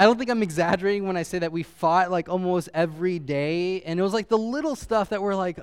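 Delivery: ordinary delivery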